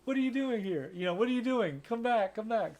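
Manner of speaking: high-pitched mock pleading call; calling after him. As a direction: act out calling someone back. Acting out Gale calling him back.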